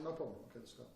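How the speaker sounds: very soft, unbothered; too calm. Acted. Man answering a shouting attacker, calm. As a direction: answer quietly, dropping away to almost nothing. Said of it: "This guy's too calm".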